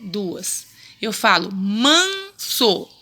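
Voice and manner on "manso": MANSO very slow, "man" held long, then "so"; syllable by syllable. Shown as a demonstration. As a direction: stretch "manso" into two separate syllables. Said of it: "duas sílabas". ("manso" bem devagar, sílaba por sílaba)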